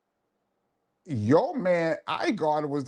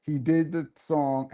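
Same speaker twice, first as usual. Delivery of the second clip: ordinary talk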